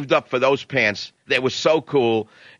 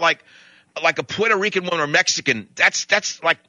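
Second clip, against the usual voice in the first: ordinary delivery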